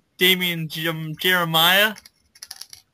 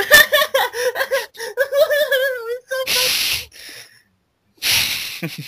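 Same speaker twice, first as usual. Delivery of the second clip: very high, shrieky fake laughing; mock giggling girl; much faster than usual. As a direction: cackle hysterically like a hallway girl. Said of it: "I hear some girl going".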